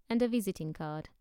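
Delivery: usual speaking voice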